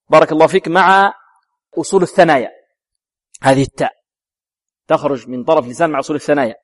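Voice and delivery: ordinary talk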